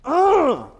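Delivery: loud, high mock grunt of frustration; a loud fake groan of annoyance; frustrated grunt. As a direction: blurt a hammed-up ugh at the broken car. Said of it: "you got out and you said".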